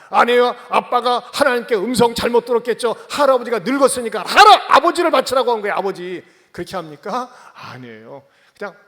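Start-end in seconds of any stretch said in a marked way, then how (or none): 0.1-6.2 높고 크게 몰아붙이는 항변; 필사적으로 크게 우기기; frantic comic protest. Acted. 이삭의 우스운 항변. (아버지에게 크게 항변하는 아들)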